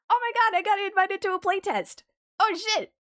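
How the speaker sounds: high, loud, squealing with real excitement; thrilled. Real, not acted. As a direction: burst out with joy at the invite. Invited to a playtest.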